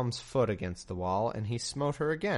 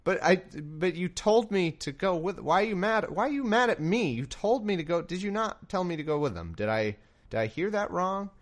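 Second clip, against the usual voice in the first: a lightly voiced confused Balaam, higher; 8 semitones higher than usual. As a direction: plead with God, baffled and higher. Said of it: "Balaam must be incredibly confused".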